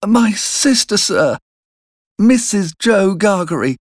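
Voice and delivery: put-on high-pitched, timid child's voice; frightened boy. A narrator's reading. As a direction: answer the convict as a scared little boy. Narrator voices frightened young Pip.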